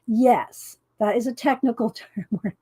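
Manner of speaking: ordinary talk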